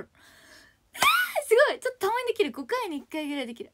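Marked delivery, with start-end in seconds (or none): 0.0-2.3 high loud slow cry of delight; excited shout. Real, not acted. Thrilled at own trill.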